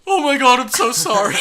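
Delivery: high, loud, laughing sorry; frantic apology. Retold or imitated. Macon right after pouring acid on Chris, high and loud in a frantic apology. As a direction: gush out a panicked apology. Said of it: "he's just like".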